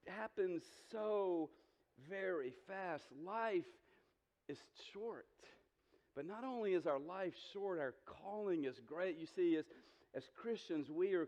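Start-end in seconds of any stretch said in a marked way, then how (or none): 0.1-5.3 drawn out, fading to near silence; slow reflective emphasis. Acted. Pastor slowing down for the congregation, soft and slow. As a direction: let it land softly and slowly. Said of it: "slowed emphatic point".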